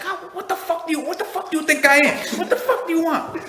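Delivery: very high, laughing tough-guy rant; Joe Pesci impression. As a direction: shriek it like an angry mobster. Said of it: "freaking Joe Pesci's like".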